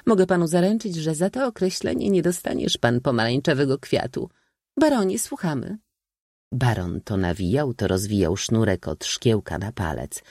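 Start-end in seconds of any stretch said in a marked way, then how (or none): 0.1-5.8 high-pitched brisk verdict; dismissive old maid. A narrator's reading. Old maid denying the count the prize, dismissive. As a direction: play a spinster judge, pitched up.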